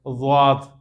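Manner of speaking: واد with the tongue's side against the back molars; daad demo. Shown as a demonstration. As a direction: say واد as the in-between daad, neither dal nor zoe. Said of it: "داڑھوں کے پچھلے سرے سے لگانا ہے".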